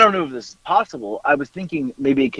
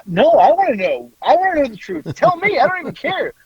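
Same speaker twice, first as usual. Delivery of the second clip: high, eager begging voice; eager pleading; 11 semitones higher than usual. Retold or imitated. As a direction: beg to be told, voice up high. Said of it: "I was like".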